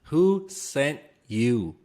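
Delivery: very slow, drawn-out question; slow dramatic. Pretend. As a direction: drag each word like a spy interrogator. Joking interrogation, slow repeat.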